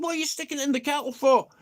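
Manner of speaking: high, fast, disbelieving; incredulous question. Retold or imitated. Asking the mate why the coke is in the kettle, high and fast in disbelief. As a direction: fire it off in shock.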